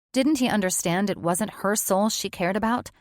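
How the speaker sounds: ordinary delivery, nothing marked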